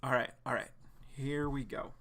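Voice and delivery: ordinary delivery, nothing marked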